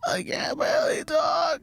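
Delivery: very high strained crying croak; sick cracked voice. Shown as a demonstration. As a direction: whimper it with a broken voice. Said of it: "It was kinda like that".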